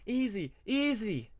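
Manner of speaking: slow, gentle calming; soothing. Acted. Tempest calming the startled Peacemaker, slow and gentle. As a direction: settle him down gently.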